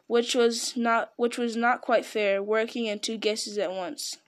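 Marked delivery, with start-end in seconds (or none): none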